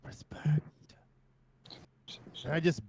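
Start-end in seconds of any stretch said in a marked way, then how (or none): 0.0-0.6 hushed menacing whisper. Acted. Player's bear whispering respect to Creamy Poo. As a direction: whisper it close to his ear. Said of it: "I whisper".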